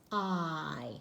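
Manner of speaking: "I" drawn out slowly as a long "eye"; teaching the long "I" sound, slowly; long vowel I. Shown as a demonstration. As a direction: stretch "I" into its own name, slow. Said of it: "this I says its own name".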